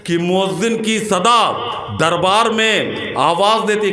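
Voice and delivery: slow, high ringing declamation, settling at the close; Josheela शेर-पाठ. Acted. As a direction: stretch each phrase out for the crowd. जोश में शेर. (खींच कर पुरजोश शेर पढ़ना)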